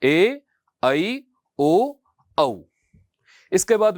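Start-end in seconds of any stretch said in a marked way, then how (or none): 0.0-2.6 teacher models the correct Sanskrit sound; correct Sanskrit vowels. Shown as a demonstration. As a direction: correct pronunciation of ए ऐ ओ औ, slow and clear. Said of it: "फिर से एक बार मेरे साथ दोहराएंगे".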